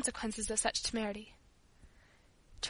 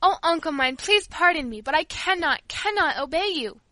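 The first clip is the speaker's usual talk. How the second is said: high, loud plea for pardon; raised, imploring outburst; crying out; 5 semitones higher, 5 dB louder than usual. A narrator's reading. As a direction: plead urgently as a distressed girl. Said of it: "she cried suddenly".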